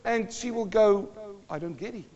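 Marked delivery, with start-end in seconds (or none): 1.5-2.1 soft, deadpan bafflement; small, quiet mock-puzzled answer; unimpressed reply. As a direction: drop the volume right down, not following. Acts wife's baffled reply.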